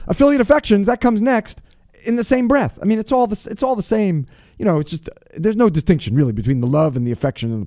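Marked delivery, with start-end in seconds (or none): 0.1-4.3 radio announcer. Acted. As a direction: announce the segment like a show opener. Segment announcement.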